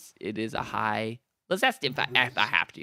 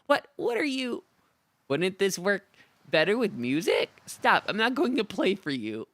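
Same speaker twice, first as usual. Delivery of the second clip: put-on high, snippy goblin girl; irritated goblin musician, pitched up; goblin bard voice; 8 semitones higher, 4 dB louder than usual.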